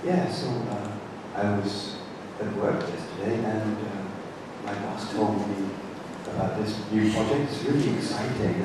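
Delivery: low, soft private chatter; quiet restaurant talk. Shown as a demonstration. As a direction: keep it down like a quiet table talk. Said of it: "we talk like this".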